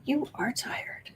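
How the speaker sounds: soft breathy whisper to a pet. Real, not acted. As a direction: whisper it gently to the cat.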